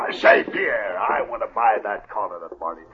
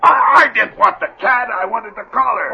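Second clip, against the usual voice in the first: high, loud frustrated cry; upset outburst; 12 semitones higher, 8 dB louder than usual. Acted. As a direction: blurt it out in dismay. Jeb upset about the lost collar.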